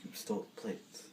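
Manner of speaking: soft, low, whispered accusation; quiet mock menace; hushed accusation. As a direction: accuse him under the breath. Hushed accusation after plates shatter.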